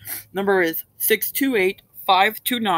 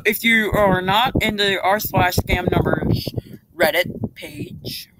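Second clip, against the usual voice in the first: ordinary talk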